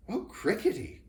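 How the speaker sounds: high, loud dismayed cry; boy groaning in dismay; groaned. A narrator's reading. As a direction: wail it in despair. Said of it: "groaned Jonathan".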